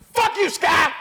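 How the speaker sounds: a hammed-up angry shout at the sky; mock furious shout. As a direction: yell it at the sky like a woman losing it. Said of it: "just cursing at the sky".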